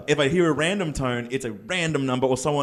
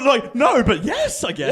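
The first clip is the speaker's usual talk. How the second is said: laughing high; 11 semitones higher, slower than usual. Real, not acted. Reply to cold caller, laughing.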